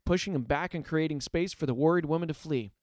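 ordinary delivery

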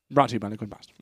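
fast, soft legal read; mock commercial disclaimer. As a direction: rattle off the fine print.

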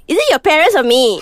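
high, loud mock ultimatum; whiny demanding lover; demanding partner.

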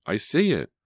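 high-pitched cry of discovery; excited, voice lifted high. A narrator's reading. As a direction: call out that you've spotted it. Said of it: "she cried, pointing".

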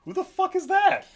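high, incredulous squawk; mock bafflement. Narrator reacting to the new king's name, high and baffled. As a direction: blurt it like a lost audience member. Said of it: "And I'm like".

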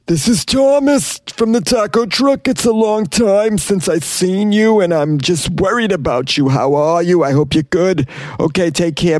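high, slow put-on voice for the taco guy's message; mock taco man voicemail. Podcaster reading the taco man's voicemail, high mock voice.

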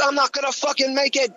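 very high, frantic wail; panicked. Retold or imitated. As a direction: cry it out in terror. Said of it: "I said".

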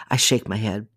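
ordinary delivery

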